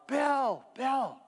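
imitated dad's yell. Dad calling his son through the woods, imitated yell. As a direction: call out high, as if across the woods. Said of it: "I heard my dad yell".

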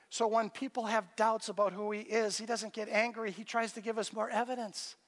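ordinary talk, nothing marked

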